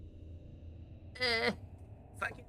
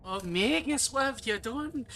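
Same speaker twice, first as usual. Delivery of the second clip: Princess Katharine impression; 7 semitones higher than usual. Streamer echoing a cartoon line, Princess Katharine impression. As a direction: echo a dismayed princess, pitch climbing on the question. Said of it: "it's Prince Catherine's voice".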